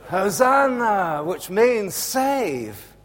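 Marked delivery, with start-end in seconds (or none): 0.1-1.2 mock crowd shout. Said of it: "And what do they shout?".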